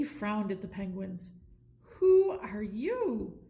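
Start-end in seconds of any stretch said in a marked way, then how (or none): 2.0-3.4 slow doubtful question; suspicious. A narrator's reading. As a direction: eye them warily. Suspicious hotel clerk.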